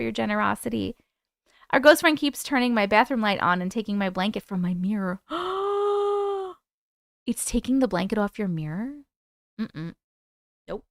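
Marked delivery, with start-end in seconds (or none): 5.2-6.5 sharp high gasp of alarm; startled gasp. Real, not acted. Streamer reacts to viewer's ghost tale with a gasp.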